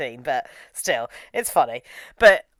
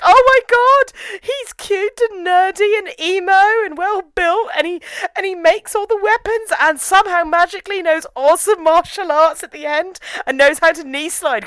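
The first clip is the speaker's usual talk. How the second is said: much higher, breathless fangirl swooning; fangirl gush; 12 semitones higher, 4 dB louder than usual. Retold or imitated. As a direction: gush over a crush. Fangirling over Helix.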